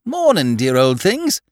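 high-pitched, breezy hello to everyone; cheerful greeting. A narrator's reading. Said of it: "said the newcomer cheerfully".

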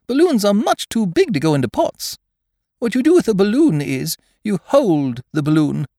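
high, emphatic bear voice; explaining Pooh. A narrator's reading. Pooh correcting Eeyore about balloons, earnest.

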